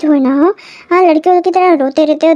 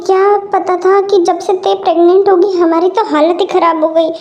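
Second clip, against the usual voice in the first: normal talking voice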